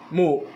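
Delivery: Mo said on a high tone; Hmong word demo. Shown as a demonstration. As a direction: give Mo a high, strong Hmong tone. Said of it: "la lettre M, le U et le S". (mot Mo prononcé avec un ton haut en hmong)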